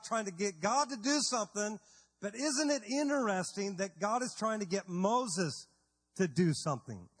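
ordinary talk

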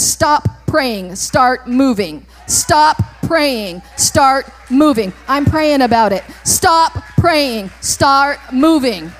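punchy, emphatic refrain with gaps between words; preaching. Real, not acted. Preacher cutting off excuses with instructions, emphatic preaching. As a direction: hammer out each short command.

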